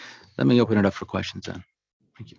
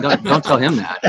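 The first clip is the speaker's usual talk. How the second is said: laughing; 5 semitones higher, 7 dB louder than usual. Real, not acted. Joking aside, laugh heard.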